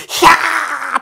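high, loud shriek of fright; retold shriek as the girls run away; scared shriek.

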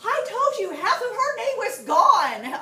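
high, loud, drawn-out mock retort; hammed-up quarrelling shout; retold shouting back. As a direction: yell it back in exasperation.

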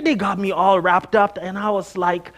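ordinary talk, nothing marked

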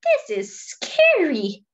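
Fred panicking among sharks, mock frightened whimper; scared elephant. Fred the elephant swimming with sharks, hammed-up fear.

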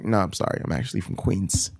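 quiet apology. Retold or imitated. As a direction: gently let the excited stranger down. Deflates hyped questioner with apology.

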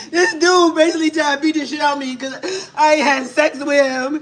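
telling it through tears; tearful account of being hit; crying. Real, not acted. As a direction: speak while crying. Beaten woman tells what happened.

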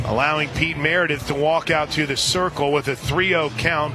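usual speaking voice

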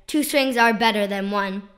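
usual speaking voice

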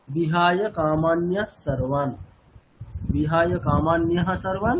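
"কামান্যা সর্বান" with a doubled s joining the words; slow chant, the s carried into "সর্বান"; visarga as s. Shown as a demonstration. Teacher chanting the earlier verse with the sandhi made. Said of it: "ওখানে আমরা বিসর্গটাকে change করছি কি?".

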